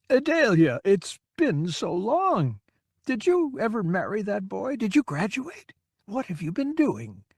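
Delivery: beam at a remembered pupil; eager old man. A narrator's reading. Confused old man greets stranger.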